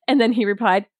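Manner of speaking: ordinary talk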